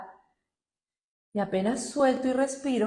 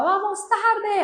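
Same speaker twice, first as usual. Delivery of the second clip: high, loud, drawn-out hurry-up yell; slow, loud retold call of "we're late"; imitating a yell; 11 semitones higher, 6 dB louder, much slower than usual. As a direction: shout at someone running late. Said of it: "Mila le gritaba".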